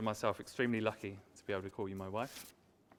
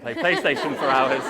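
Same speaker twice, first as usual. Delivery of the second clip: louder, higher punchline delivery; comic punchline; 4 semitones higher, 15 dB louder than usual. Acted. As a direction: land the joke loud and grinning. Punchline repeat of earlier line.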